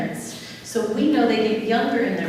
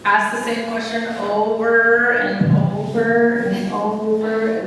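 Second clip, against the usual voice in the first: slow and slightly louder, stretching each over; slow drawn-out repetition; 5 dB louder, much slower than usual. Shown as a demonstration. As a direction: drag out the repetition to show its tedium. Mimicking repetitive questioning.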